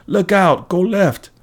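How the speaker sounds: high-pitched urgent cry; screeched warning. A narrator's reading. Gray lady warning the driver round a corner, screeched. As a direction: screech out directions in alarm. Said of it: "the gray lady sitting in the middle screeched".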